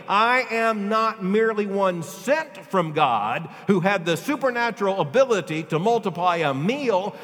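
loud, slow, soaring preacher's delivery; full-force preaching. Acted. Preacher to his congregation, full-force preaching. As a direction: preach it out with fire.

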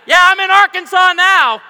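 hammed-up proud shout; mock bragging. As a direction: BB gun survivor, mock. Voicing shot kid grown up.

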